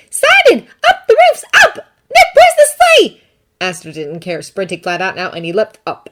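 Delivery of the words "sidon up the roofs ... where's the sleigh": very high, loud fairy shouting from the sky; calling down. Acted. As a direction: yell orders over the battle. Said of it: "Tooth called down".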